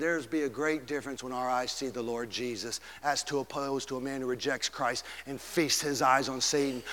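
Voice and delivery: normal talking voice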